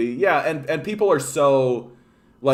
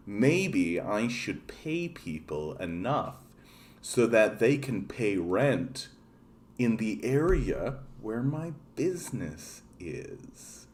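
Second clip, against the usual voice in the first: mock slow thinking; 4 semitones lower, 10 dB quieter, slower than usual. Mocking owner's slow realization.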